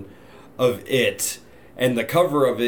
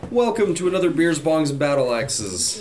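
podcast announcer intro; 5 semitones higher than usual. Pretend. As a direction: announce the show title grandly. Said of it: "so I guess I'll just say".

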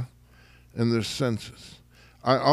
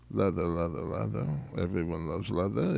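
soft leather mantra; 7 semitones lower, 7 dB quieter, faster than usual. Acted. Softly repeated leather mantra.

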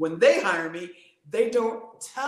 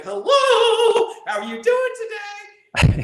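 greeting sung out high and loud; a cheery high welcome to surprised staff; bright sing-song greeting; 13 semitones higher, 9 dB louder than usual. Retold or imitated. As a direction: greet them like a cheery show host. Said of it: "me like".

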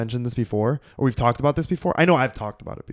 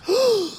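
high, sharp mock gasp; exaggerated intake of breath; delighted gasp. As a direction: gasp as if handed a gift. Reacting to promised plant gift.

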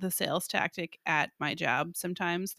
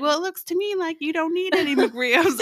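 imitating drive-thru worker; 7 semitones higher, 5 dB louder than usual. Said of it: "she was like".

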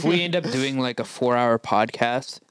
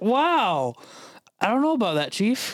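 mock reaction; 10 semitones higher than usual. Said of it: "And I'm just like".

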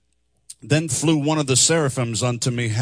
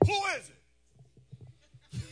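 squeaky high comic voice, very high; Mister Robinson skit voice; 12 dB quieter, much faster than usual. Retold or imitated. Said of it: "that show on Saturday Night Live".

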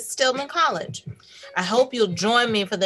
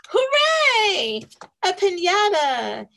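high excited cheer; excited hooray; 10 semitones higher, 4 dB louder, slower than usual. Acted. Host cheering the book title for kids, excited.

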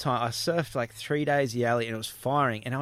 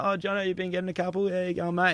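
much higher, bright matey surfer greeting; pretending to be a local; 6 semitones higher than usual. Surfer to the lineup, pretending to know everyone, in a high chummy call. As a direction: greet strangers like old mates to blend in. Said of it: "started pretending like I knew everyone".

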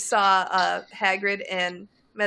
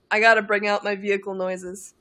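normal talking voice

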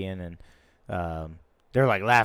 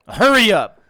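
raised, higher impatient command; teacher's impatient order; 9 semitones higher, 10 dB louder than usual. Retold or imitated. Teacher rushing the nervous boy, raised and impatient. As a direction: rush him like a stern teacher.

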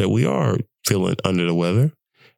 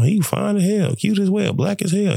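higher, a light imitation of a gushing admirer; playful admirer voice; 9 semitones higher than usual. Admirer gushing over the little brother, higher playful voice. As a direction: gush about him, pitch up.

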